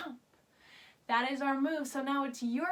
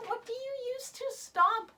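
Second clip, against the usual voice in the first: much higher, sing-song; high teacher voice asking the kids; high questioning; 10 semitones higher than usual. Shown as a demonstration. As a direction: quiz the little ones. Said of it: "quiz for kids".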